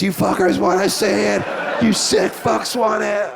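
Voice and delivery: high, strained wrestler's taunt to the audience; working the crowd. Acted. Wrestler holding a suplex, working the audience, high taunting yell.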